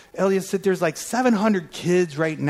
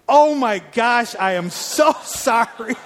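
higher, louder gasp of apology that breaks into a laugh; mortified, laughing through the sorry; embarrassed laughing apology; 6 semitones higher, 5 dB louder, slower than usual. Retold or imitated. Caller realizing it's the woman's birthday, laughs in embarrassment.

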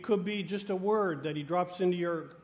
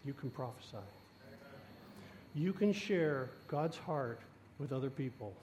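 soft voice of God; 5 semitones lower, 9 dB quieter than usual. Acted. God's words to congregation.

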